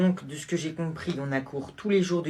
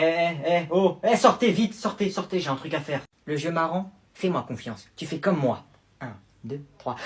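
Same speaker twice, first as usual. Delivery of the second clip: ordinary delivery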